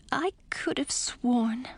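whispered, slow aside; puzzled aunt whispering to herself at the door. A narrator's reading. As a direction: whisper it half to yourself, slowly. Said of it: "she said to herself".

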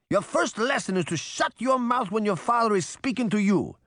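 high, emphatic angry scolding; roaring. A narrator's reading. Geppetto orders his interrupting son to be silent, roaring. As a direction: cut the boy off in an accented father's roar. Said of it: "Geppetto roared".

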